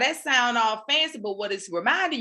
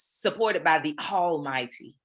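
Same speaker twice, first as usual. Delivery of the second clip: preaching; 4 semitones lower, slower than usual. Pretend. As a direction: declare it slowly like a sermon. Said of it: "I just had to preach the word".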